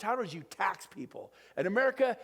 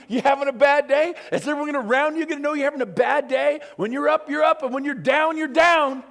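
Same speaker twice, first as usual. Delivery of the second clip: higher, louder mocking rebuke; scolding shout; 6 semitones higher, 8 dB louder than usual. Speaker scolding the audience, loud mocking shout. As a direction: call them out hard. Preacher mocking moody people.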